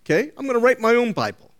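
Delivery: mock their smug plan, rattled off quickly; a hammed-up scoffer's boast. Mocking people who rewrite the Bible.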